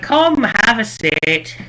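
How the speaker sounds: high, slow, drawn-out invitation; beckoning shopkeeper. Acted. Shopkeeper beckoning everyone over, high and lilting. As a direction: call them over in a lifted voice.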